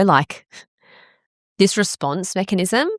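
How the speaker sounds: ordinary delivery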